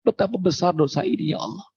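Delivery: high, tearful plea to God; crying prayer. Acted. Sinner weeping on the hill begs God's pardon, high tearful plea. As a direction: cry out for forgiveness, pitched high. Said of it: "Ada orang yang menangis mengatakan".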